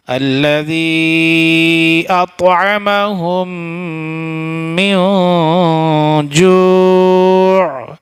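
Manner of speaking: slow melodic chant with long held notes; tajweed recitation. Shown as a demonstration. Teacher showing students the right way in melodic recitation. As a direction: chant the verse, stretching the last word. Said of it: "last میں عین ادا ہوگا".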